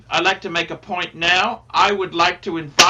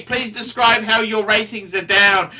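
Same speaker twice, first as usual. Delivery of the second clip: ordinary talk, nothing marked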